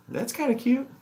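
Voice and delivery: high cooing aside; pet talk. Real, not acted. Watching pet clean itself.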